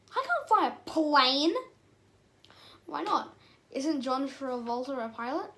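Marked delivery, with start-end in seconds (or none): 0.1-1.5 loud, slow, drawn-out protest. Acted. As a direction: stretch it out in mock disbelief. Bee protests at flying.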